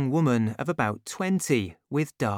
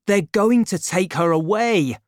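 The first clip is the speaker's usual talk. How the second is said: louder, alarmed call; urgent cry; 6 semitones higher, 6 dB louder than usual. A narrator's reading. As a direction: raise the alarm. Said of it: "D'Artagnan cried to Planchet".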